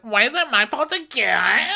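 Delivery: very high, loud whine; mock wailing girl. Blamed friend protesting in a quarrel, mock wail.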